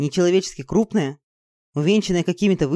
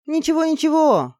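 higher-pitched, eager repetition; eager muttered reassurance; 11 semitones higher, 3 dB louder than usual. Acted. Girl eagerly watching the field, excited. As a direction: keep urging it on. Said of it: "жадно глядя на поле, приговаривала я".

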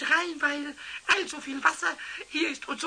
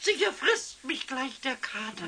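ordinary delivery

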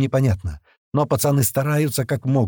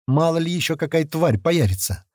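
ordinary delivery

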